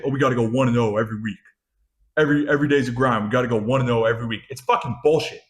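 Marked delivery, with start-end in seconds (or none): 0.0-4.4 rushed, rote recital of a slogan; mocked coach-speak. Fan mimicking coaches' one and oh talk, mocking. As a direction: rattle it off fast, mimicking a coach's cliché. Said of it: "All this one and oh bullshit".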